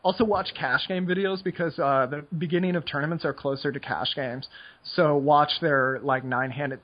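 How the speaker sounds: normal talking voice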